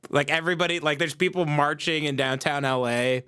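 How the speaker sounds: ordinary delivery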